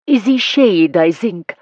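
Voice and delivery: modiste's hissed fake French; faux French accent. A narrator's reading. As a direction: sell the silk with a fake French lilt. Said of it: "hissed in her faux French accent".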